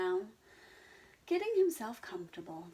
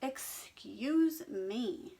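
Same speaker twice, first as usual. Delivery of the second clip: very slow, louder stern pig; 5 semitones higher, 5 dB louder, much slower than usual. Acted. Henry stopping the argument, slow and stern. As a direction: draw it out sternly. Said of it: "said Henry sternly".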